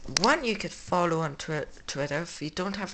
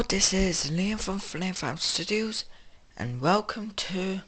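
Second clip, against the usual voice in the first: ordinary talk, nothing marked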